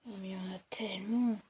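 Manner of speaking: breathy whisper in dismay; whisper, overwhelmed by enemies. Real, not acted. Surrounded by guards.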